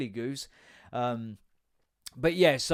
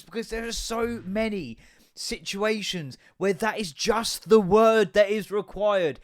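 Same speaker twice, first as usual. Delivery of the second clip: ordinary delivery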